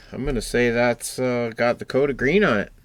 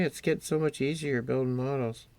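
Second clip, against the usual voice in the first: ordinary delivery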